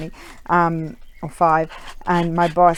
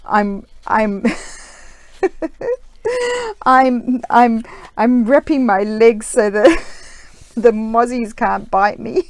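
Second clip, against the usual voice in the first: words broken up by giggles, raised and slowed; helpless laughter; 6 semitones higher, 6 dB louder, slower than usual. Retold or imitated. Retelling her husband's mosquito excuse, cracking up with laughter. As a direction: struggle to get the quote out while laughing.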